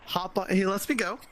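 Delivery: ordinary talk, nothing marked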